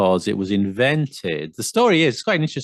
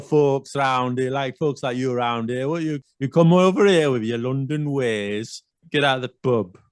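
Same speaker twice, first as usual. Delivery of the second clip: put-on higher-pitched comic Yorkshire accent; exaggerated Yorkshire; 4 semitones higher than usual. Yorkshire local warns off a London visitor, exaggerated Yorkshire accent. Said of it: "I'm exaggerating. I love Leeds, Yorkshire".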